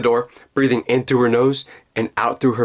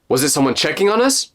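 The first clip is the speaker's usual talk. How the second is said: ordinary delivery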